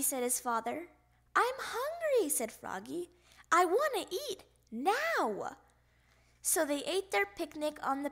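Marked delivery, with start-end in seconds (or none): 1.4-2.3 high-pitched hungry complaint; little frog demanding food; childish demand. A narrator's reading. Child demands food before leaving. 3.5-5.6 high, insistent complaint; hungry little frog putting his foot down; childish demand. A narrator's reading. Child demands food before leaving.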